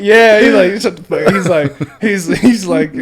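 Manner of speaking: talking through big laughter; loud laughing voice; laughing loudly. Real, not acted. Laughing retelling.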